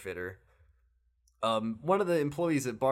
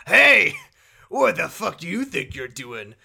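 loud, higher thug yell; mock thug shout; 5 semitones higher, 11 dB louder than usual. Thug yelling at Kiryu, loud mock shout. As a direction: bark at the stranger like a street punk. Retelling game thug confronting.

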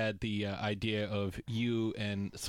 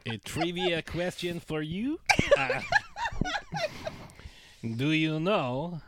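put-on goofy French-Canadian accent, Borat-like; hammy French accent, halting English; Patrice Bergeron French accent; 4 semitones higher, 4 dB louder than usual. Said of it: "Patrice Bergeron sound like Sacha Baron Cohen?".

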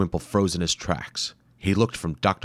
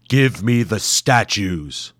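demanding monster; 2 semitones higher, 5 dB louder, slower than usual. Acted. Masked warrior demanding statues, slow and loud. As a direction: command like a horned beast. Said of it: "it demanded".

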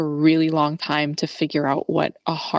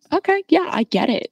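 high, fast "okay, yeah" as it dawns on you; a bright little penny-drop; mild mock aha, much higher; sudden realization; 9 semitones higher than usual. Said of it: "I'm like".

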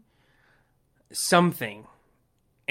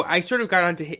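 ordinary talk, nothing marked

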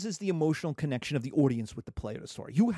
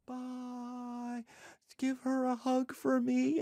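mock tender goodbye; 6 semitones higher, 4 dB quieter, much slower than usual. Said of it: "It's like".

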